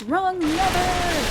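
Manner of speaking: high, drawn-out wail of dismay; dismayed cry. Real, not acted. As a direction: stretch it out in mock horror at the mistake. Pulled the wrong lever in game.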